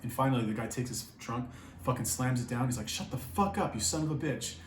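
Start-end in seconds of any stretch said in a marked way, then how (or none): 2.9-4.5 retold fury, pitched up; imitated angry outburst. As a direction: act out an angry guy without really shouting. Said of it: "he was getting angry".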